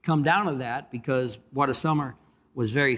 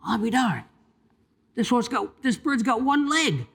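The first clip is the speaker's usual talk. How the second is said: amazed; 9 semitones higher than usual. Retold or imitated. Jockey finding the bird has one leg, high and amazed. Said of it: "And he says".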